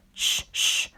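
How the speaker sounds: soft whispered shush; hushing whisper. A narrator's reading. Peter Sam hushing Sir Handel, whispered shush. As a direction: hush him gently. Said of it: "said Peter Sam".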